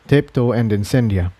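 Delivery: usual speaking voice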